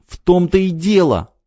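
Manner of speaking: высоко и медленно, с обидой; выкрикнуть обиженным детским голосом; обиженный выкрик. A narrator's reading. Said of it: "с обидой выкрикнула девочка". (девочка с обидой выкрикивает Крылову)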